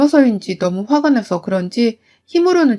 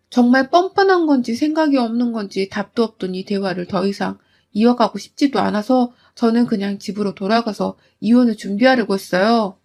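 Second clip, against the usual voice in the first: ordinary talk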